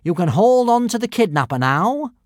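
high, loud bossy command; commanding prefect. Acted. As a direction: order the beast around loudly.

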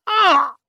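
high, loud yelp of pain; worse pain cry. A narrator's reading. Mother jerked up by pain, loud high cry. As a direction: yelp as pain stabs harder. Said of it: "And another, even worse".

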